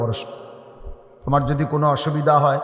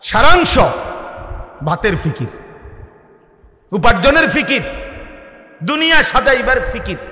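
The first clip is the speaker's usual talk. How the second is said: high, forceful sermon with surging peaks; impassioned preaching; 6 semitones higher, 3 dB louder than usual. Real, not acted. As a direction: preach it hard, rising to loud cries, then dropping back. (আবেগভরা জোরালো ওয়াজের কণ্ঠ)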